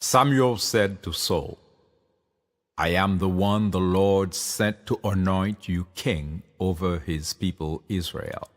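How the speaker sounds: ordinary delivery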